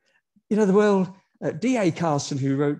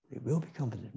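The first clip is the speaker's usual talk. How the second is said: softer repeat, will gently stressed; soft echo; 3 semitones lower, 9 dB quieter than usual. Acted. Preacher echoing the promise to the congregation, softly. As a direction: let will be comforted fall away, softly.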